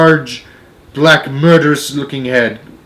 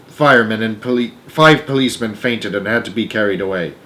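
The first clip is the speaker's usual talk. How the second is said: ordinary delivery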